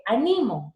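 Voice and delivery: ANIMO as a-NI-mo; middle-syllable stress. Shown as a demonstration. Teacher showing a grave word, stress on NI. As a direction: lean on NI in ANIMO. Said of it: "es una grave".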